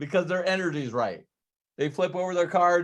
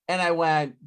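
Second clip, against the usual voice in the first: normal talking voice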